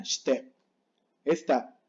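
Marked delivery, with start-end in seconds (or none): 0.0-0.5 shite squeezed to "shte"; silent I. Shown as a demonstration. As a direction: hush the I, running "sh" into "te" in shite. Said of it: "La I casi no suena".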